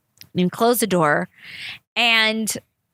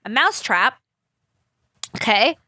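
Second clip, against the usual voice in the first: ordinary talk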